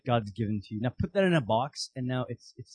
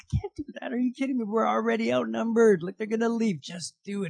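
higher, alarmed protest; panicked Gideon; 9 semitones higher than usual. Retold or imitated. Gideon protesting God's plan in a high panic. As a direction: push back in a high panic.

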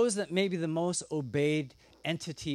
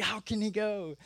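high mock disbelief; hammed-up dismay of an audience; shocked listeners; 6 semitones higher than usual. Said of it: "They're like".

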